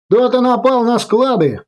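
high shouted alarm; shouting. Acted. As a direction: call out high over the gunfire. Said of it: "крикнул в ответ Кэмерон".

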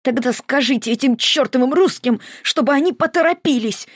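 high, moaning outburst; groaning plea. A narrator's reading. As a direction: groan it out through labour pain. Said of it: "простонала Маргарита".

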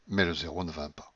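ordinary delivery, nothing marked

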